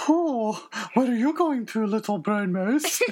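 lilting, high Scottish owl voice. Acted. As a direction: hoot it out in a Scottish accent. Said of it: "the owl was as Scottish. You go".

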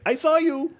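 very high, accented imitation; mock accent friend. Surfer friend after the show, imitated in a very high accented voice. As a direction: squeak it out like the foreign friend. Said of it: "she's like".

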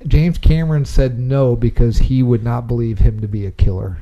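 ordinary talk

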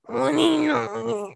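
wordless imitated babble of an ex; unintelligible mumbling impression. Mimicking a guy she dated, unintelligible mumbling. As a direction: imitate someone talking in indecipherable mumbles. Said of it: "I could never understand what he was saying".

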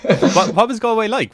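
giggling out the question; laughing. Real, not acted. Asks while still laughing.

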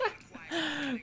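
a squeaky giggle breaking out; high giggle. Real, not acted. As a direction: giggle at the joke. Laughs recalling Melania edit.

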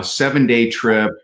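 normal talking voice

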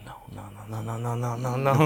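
a string of no's swelling steadily as if recoiling from the song; imitated mounting refusal; mock horrified refusal. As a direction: start very quiet and build to normal volume. Acts out refusing to hear song.

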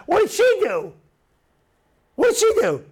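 high-pitched preacher's challenge; high, urgent prodding; insistent questioning. Real, not acted. Pressing the congregation for an answer.

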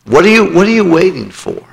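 urgent appeal. Acted. Preacher urges altar response.

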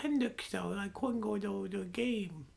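put-on high, whiny little kid voice; mock him like a small child; childish impression. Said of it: "that's my impression of you".

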